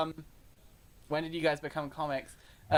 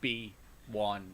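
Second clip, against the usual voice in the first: low rumbling bass; low bass note; 8 semitones lower, 8 dB quieter than usual. Shown as a demonstration. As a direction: drop to a bass growl. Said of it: "C two".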